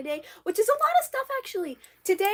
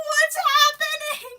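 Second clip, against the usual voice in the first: high, slow, drawn-out squeal; mock panicked wail; 12 semitones higher, 4 dB louder, slower than usual. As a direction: wail it like a bewildered cartoon character. Acting Kai bewildered by the attack.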